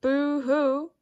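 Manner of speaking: a lighter wailing boo-hoo; mock crying. A narrator's reading. Second bird joining in, a mild mock wail. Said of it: "First one, then two".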